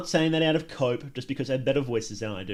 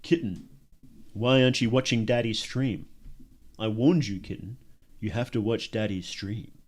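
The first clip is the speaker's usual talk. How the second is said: deep Discord daddy voice; 2 semitones lower than usual. Shown as a demonstration. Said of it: "I can deepen it for you".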